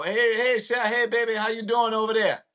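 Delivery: high, friendly New Orleans greeting; New Orleans accent. Acted. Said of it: "That's how they talk".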